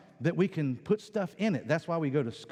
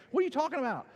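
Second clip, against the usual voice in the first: indignant mock child; 10 semitones higher, much faster than usual. Child arguing with parent.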